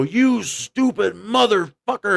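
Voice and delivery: high-pitched mock yell, drawn out; retold yell. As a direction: imitate an angry driver yelling, without real volume. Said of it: "he yelled at his window".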